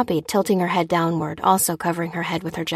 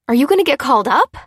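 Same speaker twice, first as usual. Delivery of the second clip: higher, louder, fast alarmed question; wife suddenly afraid for her husband, fast and louder; aghast; 8 semitones higher, 8 dB louder, faster than usual. A narrator's reading. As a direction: blurt it out in shock.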